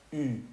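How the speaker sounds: "U" as French y, lips puckered; kiss-lipped French U. Shown as a demonstration. Teacher shows the lone U, lips as for a kiss. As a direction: say "U" like ee through rounded lips. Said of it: "como que se va a dar un beso".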